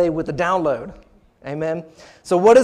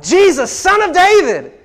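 high, slow full-voiced shout; crying out; 9 semitones higher, 9 dB louder, slower than usual. Preacher voicing the blind beggar's plea to Jesus, crying out. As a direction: cry it out with heavy emphasis. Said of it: "he cried it out".

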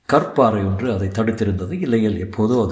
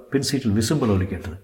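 plain reading voice

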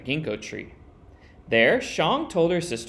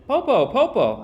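calling out; 9 semitones higher, 5 dB louder than usual. Acted. Shang calls down from the tree.

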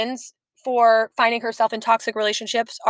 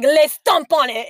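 put-on French accent; 5 semitones higher, 6 dB louder, slower than usual. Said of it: "in his French accent".

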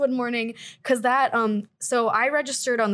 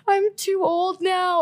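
mock wail; 8 semitones higher, slower than usual. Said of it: "I started crying".

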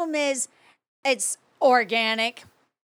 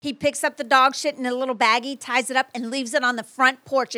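ordinary talk

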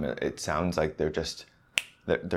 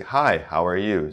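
clear, projected greeting; loud, projected voice; 4 dB louder than usual. Shown as a demonstration. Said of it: "So you're gonna get that loud voice".

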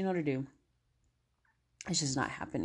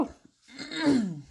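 high, loud whoop after a yawn; loud post-yawn exhalation; releasing "woo"; 9 semitones higher, 6 dB louder than usual. Real, not acted. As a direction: let out a big, releasing "woo". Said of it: "Releasing some stuff there".

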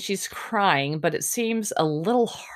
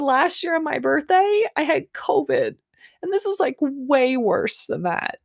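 very high imitation of tearful whining; hammed-up crying complaint, dragging out the last words; mock crying; 10 semitones higher than usual. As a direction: pitch it way up, like a weepy birthday girl being mocked. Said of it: "And she's crying".

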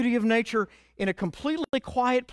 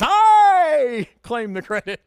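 boastful turtle; 5 semitones higher, 10 dB louder, slower than usual. Retold or imitated. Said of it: "the turtle who says".

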